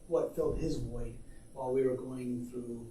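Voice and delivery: ordinary talk, nothing marked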